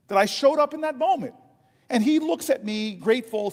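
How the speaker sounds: normal talking voice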